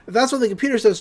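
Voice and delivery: ordinary delivery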